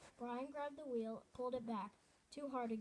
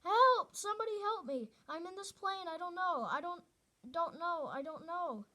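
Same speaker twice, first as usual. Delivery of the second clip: high, loud desperate screams for help; boy screaming into a dead radio for help; panicked screaming; 6 semitones higher, 8 dB louder than usual. Acted. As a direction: scream into the mic in blind panic. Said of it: "began to scream into the microphone".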